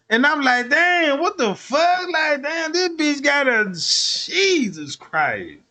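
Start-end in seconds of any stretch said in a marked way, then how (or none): 0.7-3.6 high, startled what the fuck; shocked outburst. Retold or imitated. Comedian finding girlfriend's stash, high startled outburst. 3.7-5.6 stunned. Retold or imitated. Stunned by discovery.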